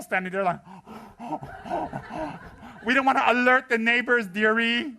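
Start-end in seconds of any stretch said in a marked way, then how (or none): 0.6-2.8 high, breathy gasp; mock excited gasp. Groupie with a flower gasps at Rinpoche, starstruck. 2.8-4.9 high, loud, laughing mock scold; mock fussy scolding. Rinpoche teases the groupies about the neighbors, high and laughing.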